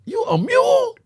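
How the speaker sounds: very high, loud disbelief; high-pitched hammed-up shock; retold incredulous questions. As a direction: screech it as an astonished question. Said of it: "I'm like".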